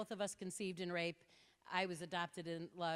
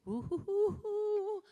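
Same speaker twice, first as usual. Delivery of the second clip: high hooting laughter; whooping laugh; 14 semitones higher, 10 dB louder, slower than usual. Real, not acted. Speaker hooting at her own comeback, high. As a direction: let out triumphant hoots. Laughing hoots after zinger.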